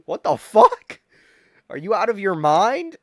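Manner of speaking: loud, high, disbelieving shout; incredulous bouncer yell. Retold or imitated. Bouncer to the student asking for his ID, shouted disbelief. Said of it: "the bouncer was like".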